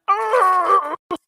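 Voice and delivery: shrill burst of laughter; high shrieking laugh. Real, not acted. Spilled liquid on computer.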